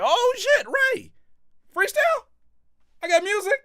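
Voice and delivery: very high falsetto fake amazement; mock surprise. Streamer mocking a staged street encounter, squeaky feigned surprise. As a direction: squeal it like a staged chance meeting. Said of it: "just happened to pop up like".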